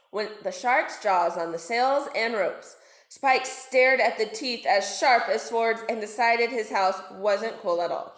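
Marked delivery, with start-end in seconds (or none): none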